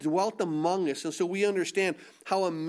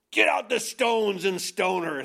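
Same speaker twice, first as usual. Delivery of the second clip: harsh mob demand; 3 dB louder than usual. Acted. Accusers demand a stoning.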